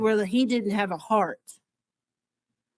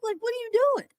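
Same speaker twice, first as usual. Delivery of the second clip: incredulous outrage; 9 semitones higher than usual. Acted. Fan ranting at the story in high-pitched disbelief. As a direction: demand an answer, getting higher with each question. Outraged rant about the witch.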